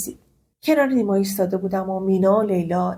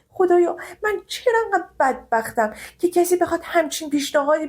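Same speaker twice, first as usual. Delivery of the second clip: high-pitched anguished crying out to God; despairing lament; 5 semitones higher than usual. Acted. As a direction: plead with God in despair, voice rising.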